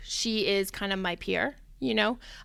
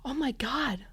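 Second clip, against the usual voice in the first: breathless gasp, then a whispered oh my God; sudden idea, whispered in awe; 4 semitones higher, 5 dB quieter, slower than usual. Real, not acted.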